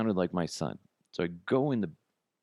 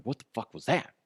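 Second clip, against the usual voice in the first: a lightly voiced confused dog, a touch higher; dog's inner voice; 6 semitones higher than usual. Dog reacting to its own fart, lightly voiced. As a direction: give the startled dog a voice. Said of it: "and they're like".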